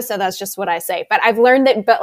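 normal talking voice

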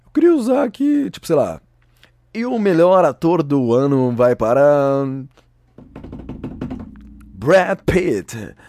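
loud, grand awards-show announcer. Pretend. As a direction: announce the winner like a host.

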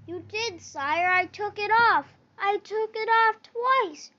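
protest, almost crying out; desperate protest. A narrator's reading. Boy protesting to the king.

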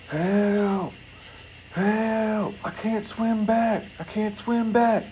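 high, drawn-out cries of help; kid yelling for help. Retold or imitated. Drowning kid calling to the beach, high pleading cries. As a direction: call out like a kid drifting away at sea.

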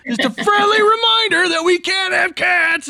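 very high, loud mock shout; hammed-up shouted reminder. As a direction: yell it way up high, overplaying it. Repeats his act, exaggerated.

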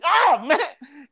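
high, loud comic groan; mock dismay. Fasting guest facing a baffled client, dismayed.

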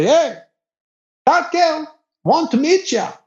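high-pitched mock call; voiced local guy. Imitates local guy calling him.

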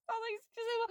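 high-pitched laughing apology; giggling through the words. Real, not acted.

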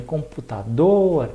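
"computador" slowly, with a long final "dor"; stress on last syllable. Shown as a demonstration. Teacher showing students the strong syllable, stressed "computador". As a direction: stretch and punch the "dor" in "computador". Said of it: "tem uma sílaba que ela é mais forte".

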